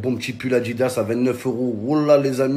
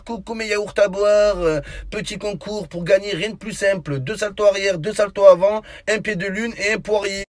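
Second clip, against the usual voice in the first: influencer voice; 7 semitones higher than usual. Pretend. Mocks influencer contest videos.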